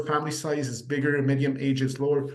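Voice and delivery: ordinary talk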